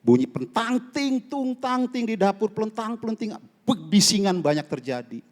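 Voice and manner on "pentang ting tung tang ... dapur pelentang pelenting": clanging pot noises. Retold or imitated. Imitating clattering kitchen noise.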